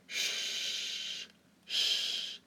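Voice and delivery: long hushing "sh-" alone; isolated retroflex "sh-", sustained; curled-tongue sh. Shown as a demonstration. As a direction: draw out "sh-" with the tongue curled back. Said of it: "have to curl your tongue".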